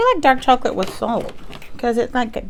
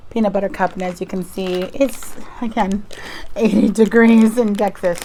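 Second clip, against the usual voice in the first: ordinary talk, nothing marked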